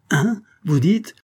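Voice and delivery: startled question. A narrator's reading. Pointre hears the wife's murder mentioned, startled high question. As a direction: jump in taken aback, pitch shooting up.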